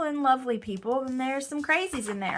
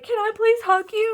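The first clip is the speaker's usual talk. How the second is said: much higher and louder, an eager emotional plea; tearful plea; 11 semitones higher, 7 dB louder than usual. Retold or imitated. Crying churchgoer begs the stranger for a hug, high and urgent. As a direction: burst out asking for the hug, pitch jumping up.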